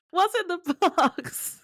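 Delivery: high, loud, drawn-out mock cry; anguished movie-quote impression. Quoting him to the co-hosts, hammed-up anguished cry. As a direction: wail it slowly as a film quote. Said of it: "And then he goes".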